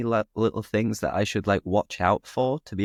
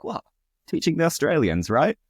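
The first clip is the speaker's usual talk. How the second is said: mock-puzzled higher-pitched question; joking; 9 semitones higher than usual. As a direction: tease with a raised, questioning lilt. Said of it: "will make a joke".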